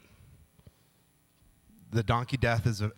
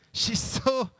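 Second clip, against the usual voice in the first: mock lovestruck gush; 9 semitones higher than usual. Smitten pastor voice, mock swoon.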